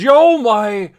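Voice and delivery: high, loud startled oh my; a real fright catching the voice; startled cry. Real, not acted. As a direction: jump at the scare and yelp it out. Sudden scare while reading.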